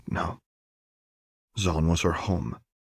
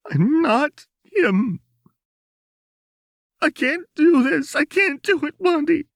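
sobbing; 7 dB louder than usual. A narrator's reading. Jinx sobbing to Lux that she can't go on, high loud crying. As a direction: wail it through tears. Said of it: "Jinx sobbed".

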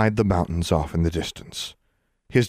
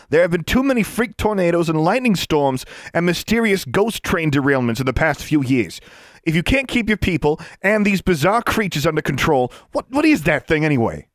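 higher, brisk official lecturing; a pushy bureaucrat scolding; Mister Johnson's voice; 10 semitones higher, 4 dB louder than usual. Acted.

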